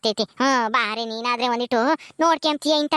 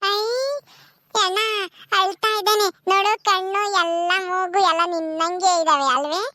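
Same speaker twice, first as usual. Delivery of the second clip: higher, lively village woman by the newborn; village women voices; 6 semitones higher than usual. Acted. Childless Gauri watching the baby, wistful and longing. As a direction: warm then wistful as she longs for a child.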